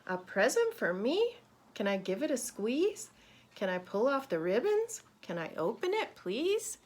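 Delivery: each question swooping up, "please" highest; pleading little storybook questions, ending upward; questioning rise. Shown as a demonstration. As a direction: lift "squeeze", "ribbons" and "please" like eager questions. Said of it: "your voice kinda comes up".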